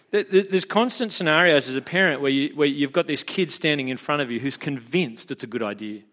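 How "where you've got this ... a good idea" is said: normal talking voice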